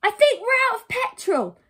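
put-on high, loud child's reply; little boy voice. Boy answers his brother in stalled car, high boy voice. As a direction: pipe up as a clueless small boy.

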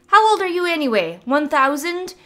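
put-on high, loud teasing girl's voice; cheeky young druid. Mavis mocking the wizard's popularity, high teasing. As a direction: needle the old man playfully.

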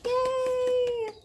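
high, loud little cheer; excited cheer. Real, not acted. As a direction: celebrate the end of the song. Cheer ending the song.

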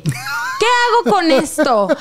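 retold "what do I do" despair, played up; exasperated desperation. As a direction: stretch it out, helplessly dramatic. Retold despair over the discovery.

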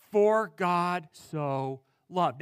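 "For God so loved" drawn out slowly and deliberately; slow emphatic reading. Shown as a demonstration. Preacher dwells on the verse.

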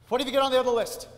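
loud, fast, angry demand. Acted. Salesman demands leads from the manager, loud and fast. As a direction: bark the question at him.

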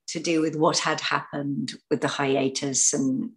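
ordinary delivery

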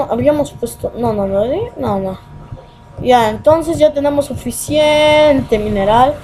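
ordinary talk

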